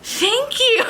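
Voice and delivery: high-pitched mad thank-you; mock angry thanks. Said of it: "He was so mad".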